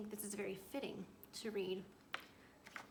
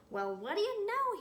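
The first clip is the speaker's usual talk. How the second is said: higher and louder, pleasantly surprised; 11 semitones higher, 11 dB louder, slower than usual. Acted. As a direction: caught off guard by a lucky find. Dodsworth finds paints in fridge.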